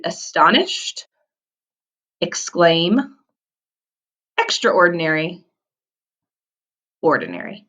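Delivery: each word said slowly with a rising call; review words. Shown as a demonstration. Teacher reviews the vocabulary for the class to repeat. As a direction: list the words for the kids to repeat. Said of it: "let's repeat".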